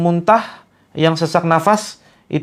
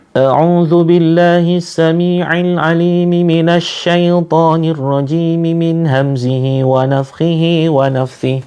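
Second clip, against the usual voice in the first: Quran recitation. Acted. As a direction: chant Al-Fatihah as a ruqyah reciter. (tilawah Al-Fatihah dengan lantunan)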